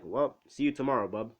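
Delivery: cocky Deadpool quip. Retold or imitated. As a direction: toss it off like Deadpool. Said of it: "he's just gonna be like Deadpool".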